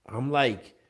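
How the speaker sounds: ordinary talk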